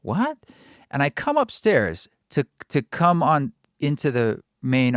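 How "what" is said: high-pitched puzzled "what?"; incredulous, much higher question; retold bafflement. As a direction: act out startled confusion, pitch jumping up. Reacting to strange loud voice.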